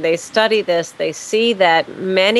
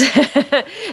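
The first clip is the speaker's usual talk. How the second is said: short, higher-pitched chuckle; wry laugh; 6 semitones higher than usual. Real, not acted. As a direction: laugh knowingly at a familiar problem. Wry laugh at common problem.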